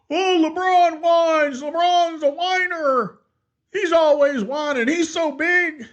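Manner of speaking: high, slow mocking whine of a critic. Imitating a LeBron critic in a high, slow mocking whine. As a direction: mimic the critic in a high whiny voice. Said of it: "I know what somebody's gonna say".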